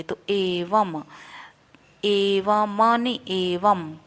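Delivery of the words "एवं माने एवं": एवं with a closing m, slow; m sound for the dot. Shown as a demonstration. Teacher shows the dot sounded as m in एवं. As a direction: say एवं ending firmly on m. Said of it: "उसको हम म का, म, उच्चारण करेंगे".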